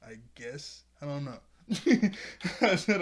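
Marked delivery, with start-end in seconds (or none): 1.7-2.6 short loud laugh, pitched up; burst of laughter. Real, not acted. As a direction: laugh at yourself. Laughs at his own uncertainty.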